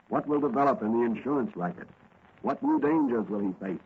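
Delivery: normal talking voice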